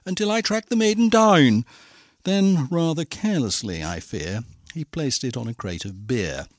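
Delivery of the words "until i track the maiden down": raised, declaiming prince voice; storybook prince's proclamation; crying out. A narrator's reading. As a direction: proclaim it like a vow. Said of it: "he cried".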